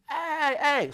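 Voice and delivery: high shouted hey; the shepherd shouting at the dog, high calls; retold yelling. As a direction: call sharply to drive off a dog. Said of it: "the shepherd just kept yelling".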